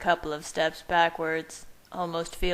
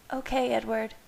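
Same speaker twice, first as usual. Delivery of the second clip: a quiet, lightly voiced woman; 5 semitones higher than usual. A narrator's reading. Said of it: "she said quietly".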